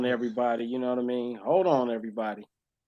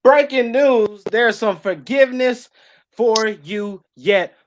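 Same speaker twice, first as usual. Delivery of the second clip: loud, higher, slow breaking-news announcer; news anchor; 5 semitones higher, 5 dB louder, slower than usual. Pretend. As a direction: trumpet it like a TV news flash. Announces forgiveness like news bulletin.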